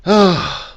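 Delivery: loud, high voiced sigh. Real, not acted. Vlogger winding down after the fixie rant, big sigh. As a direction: exhale heavily after the rant.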